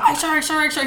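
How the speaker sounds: high AYE, SIR chanted back to back; rapid repeated reply. Retold or imitated. Recruit made to repeat AYE, SIR, high mock rapid-fire yelps. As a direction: run AYE, SIR together without a break, imitating. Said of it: "like, repeat it".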